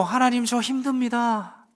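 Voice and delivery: slow, soft, sincere confession to God; honest prayer. Shown as a demonstration. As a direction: pray it quietly and truthfully. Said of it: "기도하시면 됩니다".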